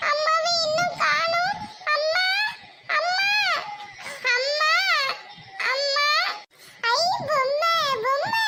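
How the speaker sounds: put-on high child voice calling for mother, rising; a small girl alone, calling out; lost little girl. (தொலைந்த சிறுமியின் உயர்ந்த குரல்)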